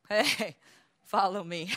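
slow, drawn-out beckoning with a laugh; mock proud recruiter. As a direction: lure them in, lazily and smug. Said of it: "they see other people coming and says".

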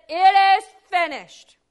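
high, loud and slow, a triumphant cry; preacher shouting the last words of Jesus; proclaimed loudly. Shown as a demonstration. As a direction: ring it out as a victory declaration. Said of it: "That's why he said".

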